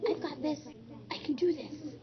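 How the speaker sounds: high, upbeat confident voice; cheerful pep talk to yourself; confident self-talk. Shown as a demonstration. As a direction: model brave self-talk for kids. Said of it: "Could you say".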